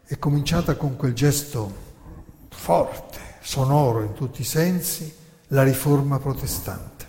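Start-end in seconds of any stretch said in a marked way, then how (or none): none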